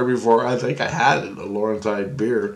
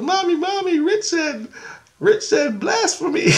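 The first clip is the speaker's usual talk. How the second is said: put-on very high child voice, tattling; squeaky mimicked three-year-old; imitated little girl. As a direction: tattle to Mommy like a tiny kid. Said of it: "she runs away to her mom".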